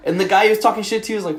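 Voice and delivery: usual speaking voice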